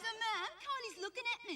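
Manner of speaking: put-on high-pitched kid dobbing on a sister; tattling little brother. Little brother tells Mum on Kylie in the car, high tattling whine.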